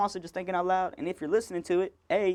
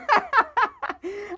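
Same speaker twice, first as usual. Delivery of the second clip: high burst of laughter; 5 dB louder than usual. Real, not acted. Laughing at own story.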